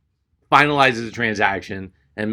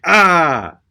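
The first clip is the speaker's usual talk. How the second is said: loud, drawn-out "ah"; mock cry of regret, falling away; frustrated yell; 6 semitones higher, 7 dB louder than usual. Customer driving away in the rental car, frustrated yell.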